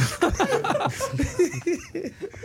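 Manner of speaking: rising high laugh. Real, not acted. Cracking up at his own bit, high rising laugh. Laugh after patois joke.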